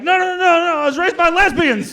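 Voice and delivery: high, loud panicked pleading; comic defending himself to a hostile room; frantic protest. Retold or imitated. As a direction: beg the crowd to hear you out.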